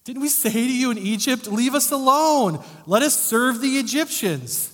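hammed-up nagging Israelites; whiny mock complaint. Preacher mocking the Israelites' complaint to Moses, high whine. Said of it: "I don't think that's exactly how they said it".

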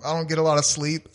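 ordinary talk

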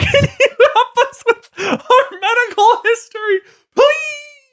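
high, laughing fake plea; mock begging. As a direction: whine it like a desperate beggar. Mock begging fans for help.

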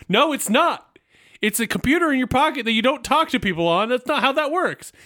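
high-pitched mock-outraged objection; indignant protest. Acts out own protest to wife.

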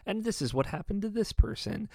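a touch of singsong presenter; epilogue presenter. Acted. Imitates credits epilogue captions.

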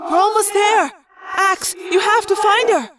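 high, echoing plea; urgent calling. A narrator's reading. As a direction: press them urgently to search. Urgent rescue under water.

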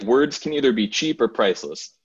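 ordinary talk

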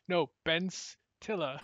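slow, Stiller stressed. Shown as a demonstration. Acting out the name joke, slow, stress on Stiller. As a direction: correct them by leaning on Stiller. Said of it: "where you stress it".

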